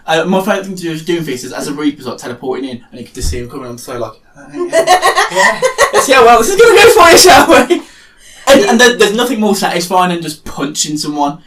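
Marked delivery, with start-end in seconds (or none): 4.4-7.8 loud, high-pitched goading quip; mock taunt. Player teleporting in to face an oncoming Doomfist, mock taunting. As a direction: taunt them in a raised, exaggerated pitch.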